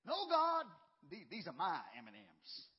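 a loud, defiant refusal to God; stubbornly refuse, like a child clutching candy; defiant "No, God," flung out loud. Retold or imitated. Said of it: "defiantly say".

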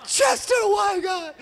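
high cry of the name, fading; hammed-up wail. Kid grieving the run-over dog, mock wail. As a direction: wail at heaven, then trail off.

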